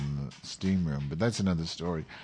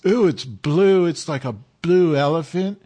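Spicoli voice; 7 semitones higher, 9 dB louder than usual. Retold or imitated. Friend raving about the blue elephant in a Spicoli voice. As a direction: gush like a stoned surfer dude, much higher. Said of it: "kinda talks like Spicoli".

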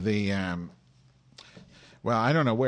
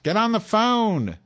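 frustrated yell; 12 semitones higher, 6 dB louder, slower than usual. Real, not acted. Yelling at absent Tom.

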